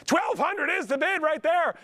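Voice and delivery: high, drawn-out jubilant call of the bid; excited bid call. Real, not acted. Auctioneer to the crowd, announcing a big jump, high and drawn out. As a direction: stretch it out and ring it high like a winning bid.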